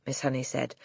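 plain reading voice

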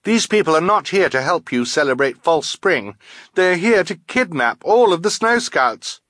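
high-pitched, pressing warning; urgent warning. A narrator's reading. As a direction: warn them before it's too late. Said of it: "Violet interrupted quickly".

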